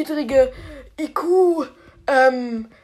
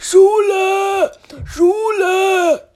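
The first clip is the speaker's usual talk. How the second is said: calling out; 4 semitones higher, much slower than usual. Acted. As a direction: shout it so the school can hear. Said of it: "rief Spike aus seinem Kinderzimmer".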